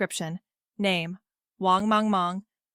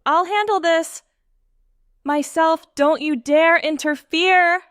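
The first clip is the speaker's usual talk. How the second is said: fierce shout; 6 semitones higher, 5 dB louder than usual. A narrator's reading. Charging at the beast.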